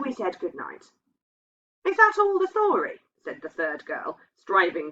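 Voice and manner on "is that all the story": surprised question. A narrator's reading. Third girl's eager question.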